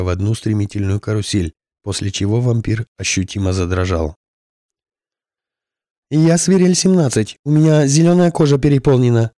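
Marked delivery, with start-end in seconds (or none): 6.1-9.3 tense, high, loud radio report; urgent pilot calling in over the radio. A narrator's reading. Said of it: "радиосвязь зазвучала голосом пилота".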